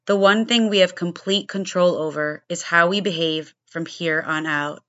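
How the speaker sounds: punchy, low-pitched show opener; scripted podcast intro. Acted. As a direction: deliver a prepared opening monologue with firm, deliberate emphasis.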